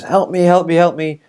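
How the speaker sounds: retold panic, pleading in quick repeats; mock scared plea. Petrified man begging to be saved from the creature, mock scared. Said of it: "he's getting petrified, scared".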